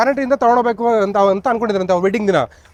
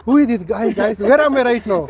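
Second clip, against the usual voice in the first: ordinary talk, nothing marked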